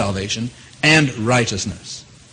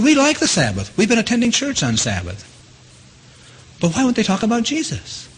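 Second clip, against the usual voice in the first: put-on raised, puzzled voice of young visitors; quoting young visitors; 10 semitones higher than usual. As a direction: ask it like earnest young people baffled. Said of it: "And they said".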